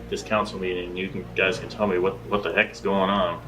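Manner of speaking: usual speaking voice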